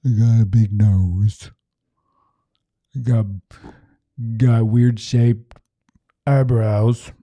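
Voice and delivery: a hint of sulky self-criticism; understated dejected griping; glum self-criticism. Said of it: "look in the mirror and go like".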